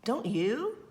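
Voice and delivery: ordinary delivery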